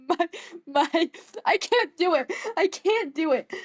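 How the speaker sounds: cracking up, can't hold the French accent; breaking into laughter mid French accent; giggling give-up. Real, not acted. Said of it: "My French accent is so bad".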